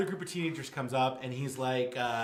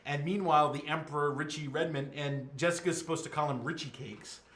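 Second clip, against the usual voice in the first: ordinary delivery, nothing marked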